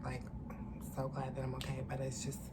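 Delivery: holding back tears. Real, not acted. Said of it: "I don't wanna cry or tear up".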